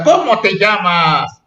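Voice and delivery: "¿Cómo te llamas?" asked slowly as a model question; question intonation. Shown as a demonstration. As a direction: read "¿Cómo te llamas?" aloud with exaggerated, drawn-out question tune. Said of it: "cómo se oye cuando digo yo con una pregunta". ("¿Cómo te llamas?" con entonación interrogativa exagerada)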